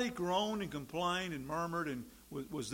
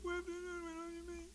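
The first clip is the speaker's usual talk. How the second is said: high, softer whiny voice; mock whining complainer; 12 semitones higher, 8 dB quieter than usual. Preacher mocking Israelites who miss meat, high whine. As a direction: whimper it like a spoiled child.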